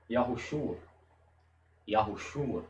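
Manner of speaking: "Yahushua" with the O almost inaudible; barely touch the O in "Yahushua"; faint O in Yahushua. Shown as a demonstration. Teacher demos the name, O almost silent. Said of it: "Perceba que eu pronuncio o O".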